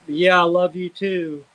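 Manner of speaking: ordinary talk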